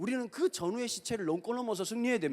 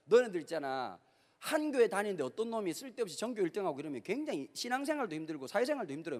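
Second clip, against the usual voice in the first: normal talking voice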